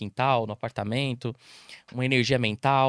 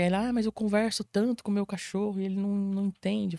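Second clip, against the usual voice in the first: mock client whine; 6 semitones higher than usual. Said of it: "eu falo assim".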